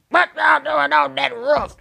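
loud, high imitated shout; high-pitched mock yell, drawn out; yelling from below. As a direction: holler it up to people on a roof. Retold yell up to roof.

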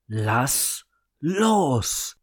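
loud furious command; furious shout. A narrator's reading. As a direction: roar it in cold rage. Sister erupts in fury.

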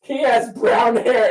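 put-on high, slow small child's voice; little girl voice. Retelling the lost girl answering her, in a child's voice.

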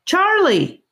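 high call across the woods. A narrator's reading. Voice in the woods calling out for the boy, high. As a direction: call his name through the trees.

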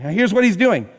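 normal talking voice

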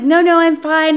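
escaping the date, frantic high refusal. Retold or imitated. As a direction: shake him off in a rush. Fleeing, refusing the ride.

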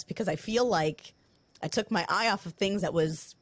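usual speaking voice

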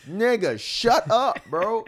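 mock yell. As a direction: yell at the seller to shut up, played for laughs. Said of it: "I'm like".

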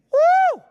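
a loud, high whoop of excitement; excited whoop. Real, not acted. Preacher whooping at the congregation, loud and high. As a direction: let out a joyful shout at the point.

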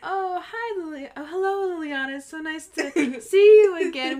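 high, gushing hostess greeting; princess greeting. Shown as a demonstration. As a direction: put on a high, gracious princess voice for a guest. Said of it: "you turn towards them and you speak like this".

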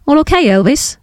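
put-on high, lilting West Country accent of an old woman; West Country old lady. A narrator's reading. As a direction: ask it like a West Country granny calling out. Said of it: "the old lady said in her West Country accent".

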